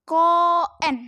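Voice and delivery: koen with the o drawn out long; long vowel reading. Shown as a demonstration. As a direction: hold the o in koen long and clear. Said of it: "Koen dibaca". (koen dibaca dengan o panjang)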